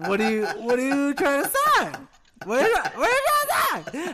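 high, indignant screech; mock offence. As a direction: squawk back as if deeply insulted. Acts out offended reply to fan.

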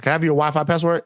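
rattled off fast; quick repeated request. Retold or imitated. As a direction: run the question together quickly.

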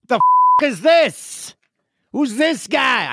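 high, loud incredulous squawk; mock-outraged friend who can't believe it; baffled golf buddy. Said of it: "Here's some Boomsies".

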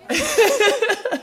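high burst of laughter; real laugh. Real, not acted. Host laughing at the IKEA joke, big laugh. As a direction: crack up at the joke.

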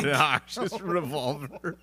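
laughing through the words, slow and loud; loud, slow, real laughter over the words. Real, not acted. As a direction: crack up mid-phrase. Laughing while speaking.

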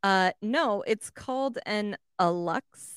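ordinary talk, nothing marked

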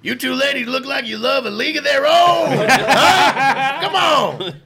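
shouted high mocking heckle through laughter; loud heckle. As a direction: yell it across the room like Dave. Imitating Dave's loud heckling.

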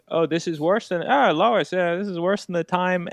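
high-pitched cartoon husband impression; Family Guy parody. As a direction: put on a cartoon voice whining to Lois. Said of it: "usually there is a joke like".

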